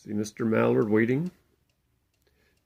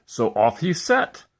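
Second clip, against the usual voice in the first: plain reading voice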